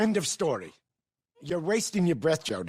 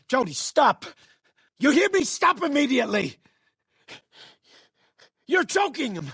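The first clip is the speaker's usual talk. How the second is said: sharp raised command; urgent command; 5 semitones higher than usual. Acted. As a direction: order her to stop now. Stopping a child choking someone.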